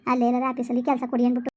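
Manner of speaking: normal talking voice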